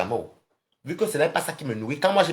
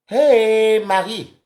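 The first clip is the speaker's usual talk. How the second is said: loud, high drawn-out hey; calling out greeting; 6 semitones higher, 6 dB louder, much slower than usual. Real, not acted. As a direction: hail a friend with a long call. Greets viewer joining the live.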